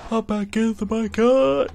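mid-yawn, words pulled high and loud. Real, not acted. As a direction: stretch the words out in a huge yawn. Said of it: "That was the best yawn in the world".

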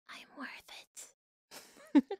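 very soft, voiceless ad whisper; hushed mock-commercial purr; whispered slogan. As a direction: whisper it like a shampoo advert tagline. Breathy parody of advert slogan.

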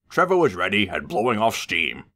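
usual speaking voice

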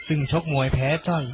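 plain reading voice

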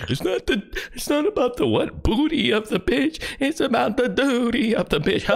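high mock voice parroting the line; host mocking the YouTuber's bit in a high voice; mocking imitation. Mocking the clip's punchline.

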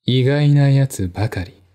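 ordinary delivery

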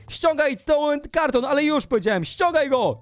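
high, insistent command; bossy angry order. Acted. Ordering the stalker to remove the box, high angry command. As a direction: order it in mock fury.

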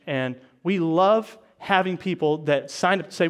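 normal talking voice

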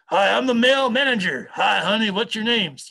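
put-on obnoxious manager voice; obnoxious male manager. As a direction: ham up a pushy interviewer, loud and high. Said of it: "can play the obnoxious".

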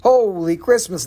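ordinary delivery

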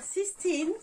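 slow French-accented English; mauvais accent anglais. Real, not acted. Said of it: "je vais peut-être pas avec mon accent". (anglais lent avec accent français)

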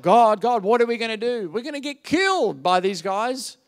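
high, loud mock-desperate plea; imitating a desperate man crying out; crying out in panic. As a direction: act out a panicked cry to God. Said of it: "Moses then cries out".

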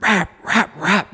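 high, mock engine rev sound; engine revving. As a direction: imitate the engine screaming near red line. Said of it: "I hear it free rev, like, three times".

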